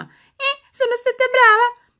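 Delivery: high, chirpy toy doll voice; squeaky doll voice. Acted. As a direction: play a cheeky little doll.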